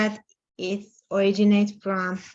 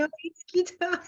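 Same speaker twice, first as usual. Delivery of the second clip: high, laughing correction of her name; 9 semitones higher, 4 dB quieter, faster than usual. Retold or imitated. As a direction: Gita laughing as she corrects people who say her name wrong. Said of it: "And I say".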